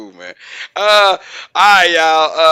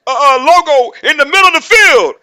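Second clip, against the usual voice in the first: high, stuttering janitor voice; imitated halting voice, high and building louder; Scooby; 10 semitones higher, 5 dB louder than usual. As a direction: stammer out a proud announcement to the pep rally. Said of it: "So he's like".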